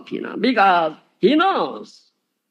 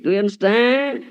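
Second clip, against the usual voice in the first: ordinary talk, nothing marked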